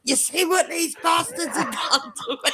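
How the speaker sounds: high-pitched pained protest with laughter; mock wailing. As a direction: wail it as an outraged appeal, half laughing. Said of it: "stood there wailing in pain".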